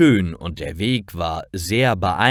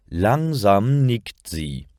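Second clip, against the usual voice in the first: ordinary delivery, nothing marked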